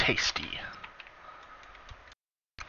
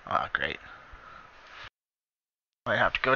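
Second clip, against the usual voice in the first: whispered, sarcastic sigh; 4 semitones lower, 11 dB quieter than usual. Real, not acted. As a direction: mutter it under breath, fed up. Resigned aside.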